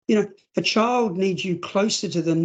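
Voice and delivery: normal talking voice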